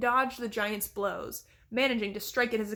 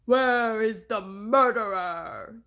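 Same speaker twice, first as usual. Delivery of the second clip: louder, slow booming giant voice; giant's bellow; 6 dB louder, much slower than usual. A narrator's reading. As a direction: boom it down like a giant. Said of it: "the giant bellowed".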